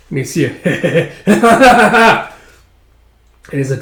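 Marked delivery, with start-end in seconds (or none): 1.2-2.3 loud, high cackle; acted scheming laugh; villainous laugh.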